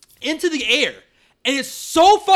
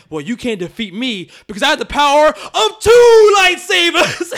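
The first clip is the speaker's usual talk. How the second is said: loud, higher, hammed-up battle cry; mock heroic shout; 5 semitones higher, 10 dB louder than usual. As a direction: shout it like a cheesy hero. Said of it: "And she's like".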